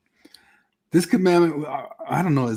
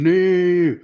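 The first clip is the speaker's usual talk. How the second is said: mock whiny no; 5 semitones higher, 5 dB louder than usual.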